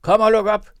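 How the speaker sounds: high, loud command; shouting. A narrator's reading. Robber woman shouting at the door, loud. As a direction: bang out the order. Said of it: "råbte hun derpå".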